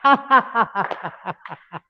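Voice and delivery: clapping laughter; laughing while clapping. Shown as a demonstration. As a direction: laugh freely as you clap. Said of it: "Clap your hand".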